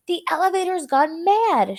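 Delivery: alarmed shout. A narrator's reading. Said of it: "shouted Grandpa Joe".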